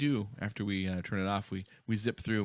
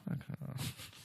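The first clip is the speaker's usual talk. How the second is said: mock dismissive; 10 dB quieter than usual. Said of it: "bothering to go to the boss".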